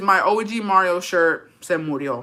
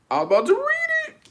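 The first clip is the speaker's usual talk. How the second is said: much higher, cartoonish self-mockery; squeaky mock voice. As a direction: parody yourself in a squeaky voice. Mocking herself in a voice.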